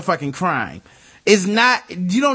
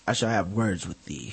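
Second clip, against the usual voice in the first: put-on much lower, softer old-English warning; mock archaic threat; 11 semitones lower, 9 dB quieter than usual. Host voices the knife man in old English, low and soft. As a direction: murmur a quaint threat.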